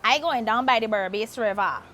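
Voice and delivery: Guyanese accent, I going down; Guyanese saying. Shown as a demonstration. Host teaching a Guyanese sentence in its accent.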